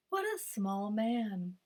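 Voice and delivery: a hint of a doubtful farmer; farmer's musing. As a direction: muse it quietly to yourself. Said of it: "thought the farmer".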